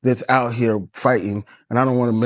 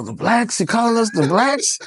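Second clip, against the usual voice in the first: higher, incredulous comeback; mock disbelief; 8 semitones higher than usual. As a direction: question it in playful offence. Acted indignant reply to Trump quote.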